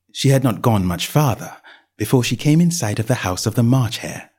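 ordinary delivery

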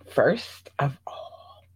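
slow, breathy secret whisper of "first of all". Shown as a demonstration. Repeats opener secretively.

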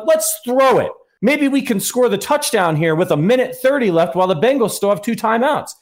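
high-pitched mock-eager voice; mock brainwave, pitched well up; mocking imitation. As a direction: mimic a foolishly hopeful decision. Mocking the coaches' foolish reasoning.

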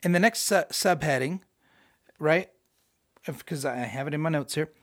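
usual speaking voice

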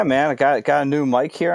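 ordinary talk, nothing marked

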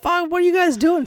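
put-on a trucker yelling at the kids; mock yelling. Truck driver yelling at fireworks shooters, mock angry yell. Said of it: "starts yelling at us".

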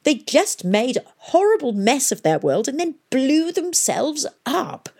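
high, swooping whine; whining. A narrator's reading. Arkengarth scorns the ancients to his colleagues, whining. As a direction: complain in a peevish whine. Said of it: "whined Arkengarth".

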